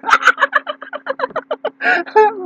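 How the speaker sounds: a long high laugh; real amused laughter. Real, not acted. Laughing at own joke.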